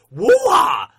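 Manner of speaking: loud, high rap ad-lib yell; hip-hop shout. Retold or imitated. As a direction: belt out a rap hype call. Wu-Tang style shout.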